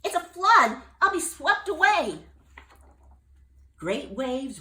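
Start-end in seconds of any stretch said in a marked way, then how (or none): 0.0-2.2 high, loud alarmed bullfrog; terrified of the water; panicked. Acted. Jubal fearing the flood, panicked.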